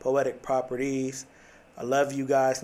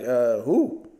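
puzzled questioning "who?"; acted confusion; 6 dB louder than usual. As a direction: play a baffled, hesitant question. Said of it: "Now I'm confused".

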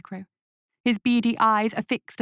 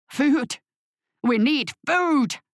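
desperate cry for food; 5 semitones higher, slower than usual. A narrator's reading. Starving old farmer crying out to the emissary for food, desperate. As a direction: seize on the word with hungry hope. Starving man hears of aid.

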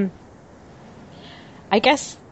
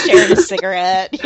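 laughing; 9 semitones higher, 8 dB louder than usual. Real, not acted. Joking about Jamie and Cersei.

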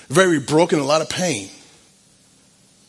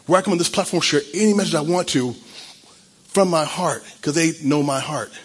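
ordinary talk

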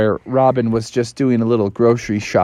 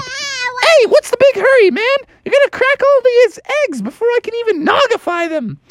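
squeaky Robin voice. Acted. Robin complains, very high.

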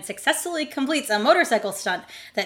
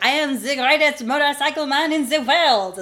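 mock French accent; 4 semitones higher than usual. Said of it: "his stupid froggy accent".

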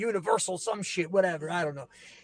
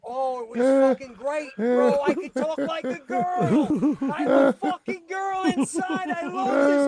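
excited; 7 semitones higher, 4 dB louder than usual. Real, not acted. Excited, laughing about fooling school.

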